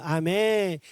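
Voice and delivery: ordinary talk, nothing marked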